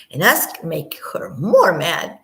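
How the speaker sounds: slow whisper; hushed gossip; whispered aside. Real, not acted. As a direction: confide it under your breath. Aside about her.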